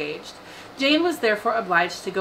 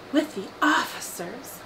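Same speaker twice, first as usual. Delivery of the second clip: higher, drawn-out cry; mild exclamation; 6 semitones higher, slower than usual. A narrator's reading. Young sister hearing of the officers' dinner, mildly crying out. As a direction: exclaim it slowly with a raised pitch, lightly acted. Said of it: "cried Lydia".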